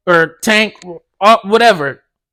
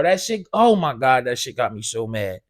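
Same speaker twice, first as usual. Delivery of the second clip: seething; 10 semitones lower, 9 dB quieter than usual. Real, not acted. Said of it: "as you can tell, I'm still heated".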